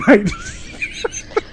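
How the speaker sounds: high-pitched burst of laughter; high squealing laugh. Real, not acted. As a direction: crack up in a squeal. Laughing at own joke.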